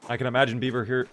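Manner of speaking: ordinary talk, nothing marked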